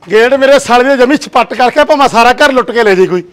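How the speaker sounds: high, loud scolding complaint; husband scolding loudly about the gate left open; grumbling loudly. Acted.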